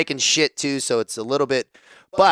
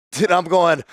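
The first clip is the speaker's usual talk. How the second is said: normal talking voice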